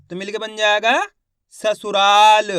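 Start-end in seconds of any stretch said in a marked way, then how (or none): 1.5-2.5 ससुराल loud, with a long held रा; syllable-by-syllable reading. Shown as a demonstration. Teacher blends letters for learners, loud syllabic ससुराल. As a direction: sound out स-सु-रा-ल emphatically. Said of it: "एक एक अक्षर का उच्चारण".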